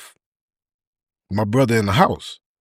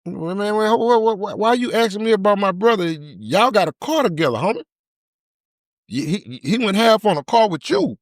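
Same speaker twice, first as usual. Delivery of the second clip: much higher, pressing accusation; suspicious demand, pitched way up; indignant challenge; 9 semitones higher than usual. Retold or imitated. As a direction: call him out, voice up high. Confronting the nervous dude.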